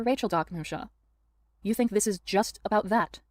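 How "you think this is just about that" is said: sneering fake sweet; coy, sneering put-upon tone; bitch voice. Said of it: "bringing out the bitch voice".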